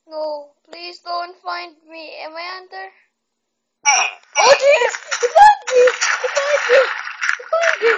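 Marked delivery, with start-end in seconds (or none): 3.9-7.9 much higher, much louder yell; shocked shouting. Real, not acted. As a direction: scream it out, just got caught. Caught by the hunter.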